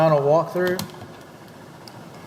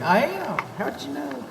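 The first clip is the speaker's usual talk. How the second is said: hillbilly voice; 9 semitones higher than usual. Pretend. Playful hillbilly back-and-forth.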